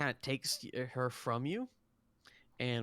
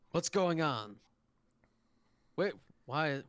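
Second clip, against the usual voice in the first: raised, louder demand from a startled captain; higher, louder, startled questioning; alarmed captain; 4 semitones higher, 5 dB louder than usual. Acted. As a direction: call it out as if arriving on a baffling emergency. Captain runs in to a shout.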